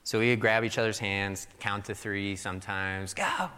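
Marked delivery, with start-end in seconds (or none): 3.1-3.5 put-on high-pitched kid's starting call; quick high "go", as if bolting; imitated urgent go. Children counting to three, then running.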